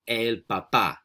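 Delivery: "El papá" measured and distinct, for repeating; model pronunciation. Shown as a demonstration. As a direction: model "El papá" for learners, stressing the last syllable. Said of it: "I'm gonna say the word three times".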